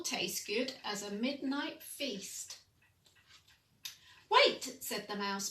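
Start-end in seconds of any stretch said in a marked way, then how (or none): 4.3-4.8 very high, loud sudden "Wait"; urgent cry. Acted. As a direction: stop her before she bites. Mouse stops the Gruffalo's child.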